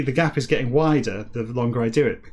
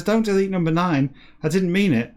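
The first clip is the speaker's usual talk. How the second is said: panicked plea; 6 semitones higher, 4 dB louder than usual. Retold or imitated. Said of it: "he says".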